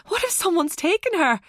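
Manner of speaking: high, panicked question; crying out. A narrator's reading. Mother fearing her child was taken, crying out. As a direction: cry it out in rising dread. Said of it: "Laura cried".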